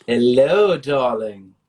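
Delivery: put-on British accent, playful; hammed-up British politeness; mock British accent. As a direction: play at being splendidly British. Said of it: "when I just spoke to him, he did have one".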